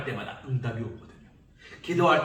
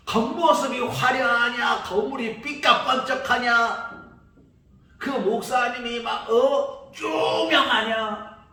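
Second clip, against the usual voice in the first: higher, louder, slow sneering questions; mocking; 9 semitones higher, 7 dB louder, slower than usual. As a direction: drag out each word in mock disdain. Mocking shallow church criteria. (겉모습만 보는 사람을 비꼬는 말투)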